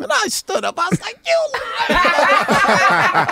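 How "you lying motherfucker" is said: high, loud, laughing and drawn out; laughing accusation. Retold or imitated. Friend laughing at Prince's denial. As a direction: crack up while calling him a liar.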